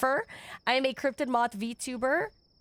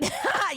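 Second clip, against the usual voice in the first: high giggle; 12 semitones higher than usual. Real, not acted. Laugh after chat line.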